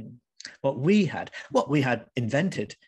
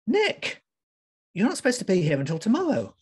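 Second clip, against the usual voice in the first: startled; 9 semitones higher, 3 dB louder than usual. Acted. Housemate caught off guard.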